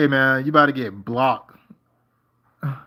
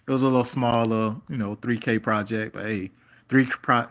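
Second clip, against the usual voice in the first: usual speaking voice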